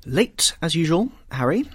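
high, loud scolding aunt; chiding cry. A narrator's reading. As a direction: call out a teasing rebuke. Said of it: "cried his aunt".

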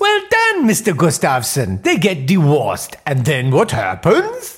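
executive meeting in a put-on Swedish accent; comic Swedish boardroom voice, rising pitch. Mister Carlson pitching strategy in a Swedish accent. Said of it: "do a funny foreign accent".

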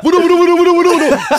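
high excited chatter of questions; eager cops. Retold or imitated. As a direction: burst in dying to know what happened. Cops rushing in curious.

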